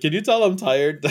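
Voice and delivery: ordinary talk, nothing marked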